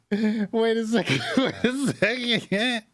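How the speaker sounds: laughing through the words; can't stop laughing as he says it. Real, not acted. Reactor cracking up at the streamer.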